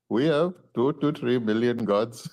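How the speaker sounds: slow Indian-accented English, imitating the shop clerk; a clear Indian accent, said slowly. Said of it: "an Indian accent".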